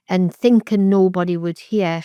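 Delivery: ordinary talk, nothing marked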